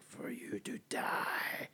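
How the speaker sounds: slow, menacing alien whisper. Retold or imitated. Imitating the captured alien's reply to its questioners, slow whisper. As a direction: rasp it out in a drawn-out, voiceless whisper.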